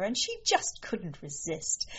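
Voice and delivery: plain reading voice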